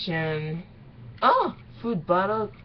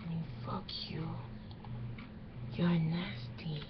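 hushed whispered reaction; 13 dB quieter than usual. Real, not acted. Whispering her disgust at the fan fiction, hushed. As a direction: breathe the words out with no voice.

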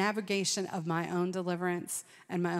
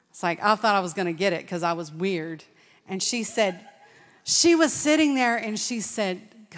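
normal talking voice

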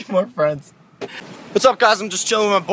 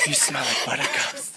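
creepy whisper in the ear; 7 semitones lower, 11 dB quieter than usual. Acted. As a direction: breathe it like a creep.

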